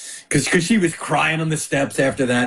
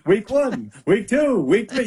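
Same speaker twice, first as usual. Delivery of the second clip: higher-pitched radio host impression, counting; Mike Francesa bit; 6 semitones higher, slower than usual. Guest riffing on a radio host's old bit, light impression. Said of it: "like, Mike Francesa bit".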